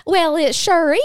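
high, loud Southern phone reply; Southern accent. Retold or imitated. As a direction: play along in a hammed-up Southern accent. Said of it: "had to just roll with it".

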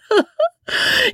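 high-pitched merry giggle; short burst of high, merry laughter. A narrator's reading. Wife laughing at her husband's boast, merrily. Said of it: "long and merry laugh".

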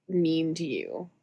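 ordinary talk

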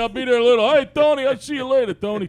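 put-on New York accent, calling out to Tony. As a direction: shout to a buddy across the job site in New York accent. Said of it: "Anybody do a Yankee accent?".

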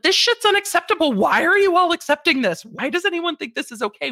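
outraged youngster scoffing at the system; mocking younger generations. Young worker mocking old norms, high and indignant. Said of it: "younger generations being like".

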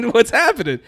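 high, loud question through laughter; baffled, laughing at the situation; laughing disbelief. Retold or imitated. Asking the painter, laughing and high.

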